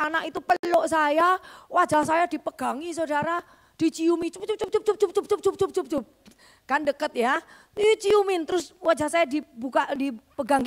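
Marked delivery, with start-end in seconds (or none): 4.3-6.0 quick smacking cup cup cup kisses; kiss sounds. Retold or imitated. The grateful daughter covers her mother's face with kisses. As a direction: pepper the line with little kisses.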